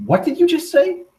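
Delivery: disbelieving outburst, pitch shooting up; freaking out. Retold or imitated. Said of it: "Sammy just freaks out".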